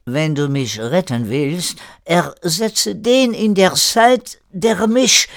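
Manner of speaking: plea building high and loud; urgent pleading. Acted. Erik begging René, increasingly urgent plea. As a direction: press harder and harder until cut off.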